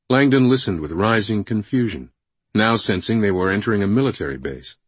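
ordinary delivery, nothing marked

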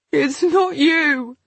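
sobbing. Acted. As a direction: choke it out through sobs. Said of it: "I sobbed".

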